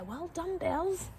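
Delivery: praising voice for the horse; sweet high praise for a pet. Real, not acted.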